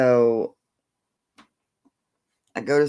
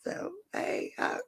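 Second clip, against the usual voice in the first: whispered aside; 12 dB quieter than usual. Real, not acted. Aside to the dog.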